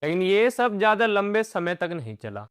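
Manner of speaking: usual speaking voice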